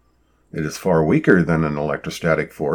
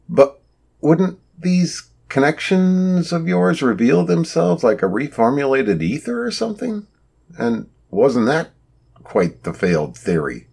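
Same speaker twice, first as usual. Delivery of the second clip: sarcastic; 8 semitones higher than usual. A narrator's reading. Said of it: "asked sarcastically".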